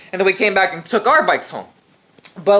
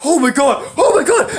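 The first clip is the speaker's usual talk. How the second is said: high, retold panic; panicked exclamation; 5 semitones higher than usual. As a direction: act out horror at a friend's crash.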